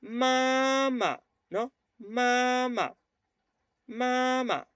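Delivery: "mamá" said with a tone on each syllable; high, sustained Mandarin "mamá". Shown as a demonstration. Teacher showing the class Mandarin tones. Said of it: "cada tono yo puedo decir, por ejemplo".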